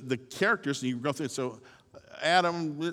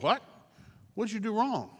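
higher, stunned repeat of the question; incredulous echo; 6 semitones higher than usual. Acted. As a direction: throw it back in disbelief. Job echoing in disbelief.

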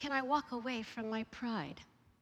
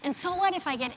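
loud, higher, defiant retort; mad outburst; 6 semitones higher, 8 dB louder than usual. Retold or imitated. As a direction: snap back like an angry driver. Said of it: "which made me double mad".

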